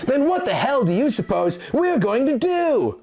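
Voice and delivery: mock growl. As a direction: throw the question back in frustration. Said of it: "Link growled".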